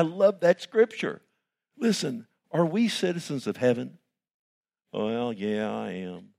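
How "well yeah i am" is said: quiet, unenthused mock reply; mock half-hearted answer. Imitating a lukewarm believer, quiet and unenthused. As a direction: say it like you barely care.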